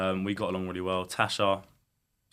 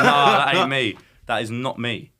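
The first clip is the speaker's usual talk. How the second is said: ordinary delivery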